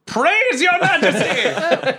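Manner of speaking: slow, high, laughing mock praise; mock cheer. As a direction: drag out a hammy cheer.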